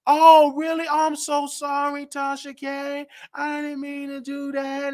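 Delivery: high, mock-innocent apology; acting dumb. To Tasha, pretending innocence, high mock-sweet apology. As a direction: play dumb, sugary and sorry. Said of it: "I'm gonna act dumb with you".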